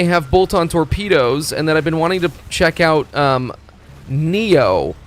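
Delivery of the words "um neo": ordinary talk, nothing marked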